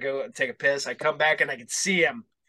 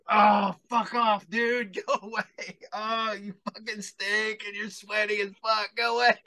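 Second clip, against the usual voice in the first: annoyed brush-off; 6 semitones higher than usual. Retold or imitated. Brushing off a stinking drunk.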